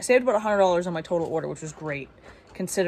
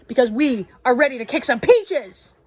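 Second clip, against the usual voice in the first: louder, pumped-up battle cry; rallying cheer; 4 semitones higher, 7 dB louder than usual. Real, not acted. Vlogger psyching herself up to work, pumped-up rallying cheer. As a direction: fire yourself up to get to work.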